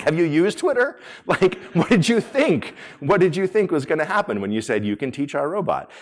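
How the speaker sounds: high, loud disbelief; incredulous scolding. Acted. Speaker chiding naive chatbot makers, loud and incredulous. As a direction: drag out an exasperated, emphatic question.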